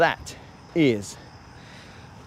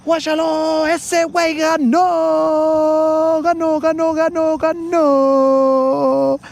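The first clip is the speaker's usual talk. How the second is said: much higher and louder, drawn-out Mexican announcer calling the win; yelling announcer; 7 dB louder, much slower than usual. Pretend. Mexican announcers yelling that he won, high and drawn-out. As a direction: stretch each ganó like a stadium call. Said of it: "they keep yelling that".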